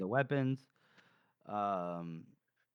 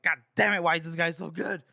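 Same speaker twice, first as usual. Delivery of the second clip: louder, higher frustrated groan; exasperated outburst; 4 semitones higher, 5 dB louder than usual. Retold or imitated. Temple cursing at Kadia's goodness, exasperated outburst. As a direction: throw up your hands in disbelief.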